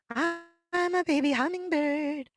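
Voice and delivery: high, slow little-bird puppet voice; a tiny bird says hello; baby bird voice. Pretend. Puppet hummingbird to the children, high and slow.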